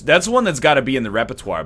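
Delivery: ordinary talk, nothing marked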